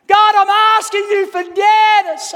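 high, shouted plea to God; loud pleading prayer. Retold or imitated. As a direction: plead at full volume, voice pitched high. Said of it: "I got louder and louder".

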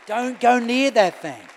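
loud, slow, drawn-out warning; urgent warning shout. Acted. Pastor warns the congregation off a trap, loud and slow. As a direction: bellow it like a frantic alarm.

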